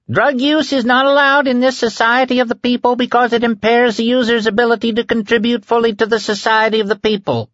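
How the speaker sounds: high, rapid-fire official reading; fast auctioneer proclamation. A narrator's reading. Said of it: "He spoke fast, like an auctioneer".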